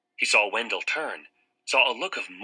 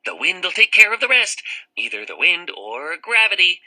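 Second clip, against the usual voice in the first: raised, louder sinister taunt; menacing character voice; 9 semitones higher, 6 dB louder than usual. Acted. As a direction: threaten him over the roof edge. Character voice in story.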